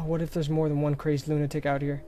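ordinary talk

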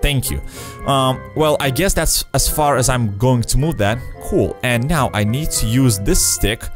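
normal talking voice

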